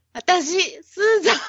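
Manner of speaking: breaking up mid-intro; giggling through the line; laughing. Real, not acted. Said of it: "あるって思ってるやん".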